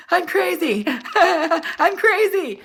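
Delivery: high, loud cackling laugh; laughing mock-girlfriend shouting she's crazy; manic laughing. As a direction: laugh like you've lost it.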